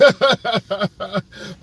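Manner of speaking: high, loud burst of laughter; real laugh. Real, not acted. Driver amused by own Cashew nuts pun, laughing out loud. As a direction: crack up at your own joke.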